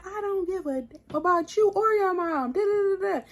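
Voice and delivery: high-pitched retold argument yell; mock yelling. Woman yelling back at her boyfriend, high mock yell. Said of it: "I'm yelling".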